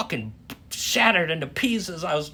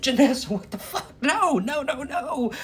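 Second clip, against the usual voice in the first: shocked protest; 10 semitones higher than usual. Retold or imitated. Stopping her in the theater.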